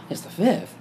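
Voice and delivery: taken aback, voice up; startled question. Retold or imitated. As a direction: repeat it in startled disbelief. Startled reaction to Mary's birthday.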